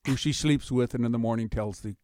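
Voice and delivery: usual speaking voice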